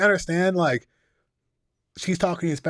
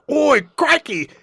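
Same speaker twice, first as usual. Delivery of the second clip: a hammed-up hard British accent, much higher and louder, slow; a slow, loud cartoon Cockney British accent; mock British accent; 12 semitones higher, 7 dB louder, slower than usual. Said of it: "the hard British accent".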